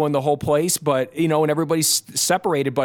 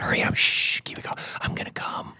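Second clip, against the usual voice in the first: whispered, hushed and urgent; quiet at night; 6 dB quieter than usual. Retold or imitated. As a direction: whisper so the baby stays asleep. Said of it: "instead of just kinda like".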